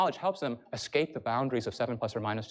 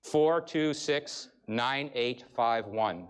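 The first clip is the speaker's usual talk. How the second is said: each digit read out slowly and evenly, spaced apart; slow, measured number reading; slow digit dictation; much slower than usual. Shown as a demonstration. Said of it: "Repeat after me".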